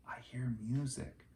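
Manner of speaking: soft awed whisper. A narrator's reading. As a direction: whisper it, hearing something strange. Whispered line.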